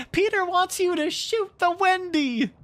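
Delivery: very high, loud jealous fairy voice; shrill fairy. Acted. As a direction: trick the boys with a piercing cry. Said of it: "the shrill voice of Tinkerbell".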